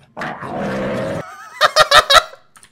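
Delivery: loud, high, long cackle; explosive high-pitched laughing fit. Real, not acted. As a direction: completely crack up. Cracks up at the video.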